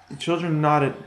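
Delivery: ordinary delivery, nothing marked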